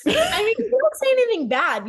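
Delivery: high, loud, animated protest; excited. Real, not acted. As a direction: jump in excitedly. Excited defence of friend.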